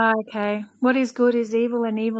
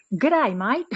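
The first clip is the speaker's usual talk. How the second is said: overdone Australian. Shown as a demonstration. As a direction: overplay the Australian "G'day, mate". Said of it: "That's overexpressing".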